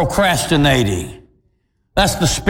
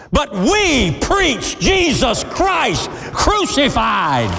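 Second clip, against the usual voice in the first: fiery preaching; 11 semitones higher than usual. Acted. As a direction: preach it full force to the crowd. Preaching at full force.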